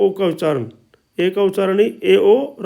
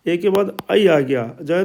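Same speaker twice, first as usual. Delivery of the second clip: usual speaking voice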